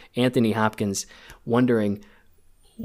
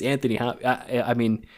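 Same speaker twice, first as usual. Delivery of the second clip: normal talking voice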